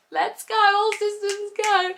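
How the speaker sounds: high, slow mock announcement of all systems go; mock alarm call. Baby waking the moment she sits, mock high alert voice. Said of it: "It's like".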